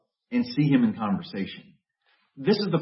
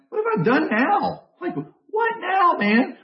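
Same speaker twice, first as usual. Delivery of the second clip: much higher, whiny little-brother protest; exasperated younger brother. Retold or imitated. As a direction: whine back at the big brother. David's exasperated reply voiced.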